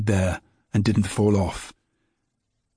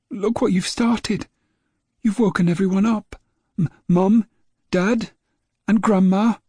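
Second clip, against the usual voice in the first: put-on high, stammering boy's voice; a little boy stumbling on his words, fed up with his dog; young boy James; 11 semitones higher than usual. A narrator's reading. Small boy grumbling at his barking dog, high and stammering.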